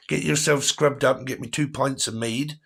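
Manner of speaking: jokey West Country yokel greeting a lover; playful West Country accent, lightly hammed up. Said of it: "that kind of accent where it's like".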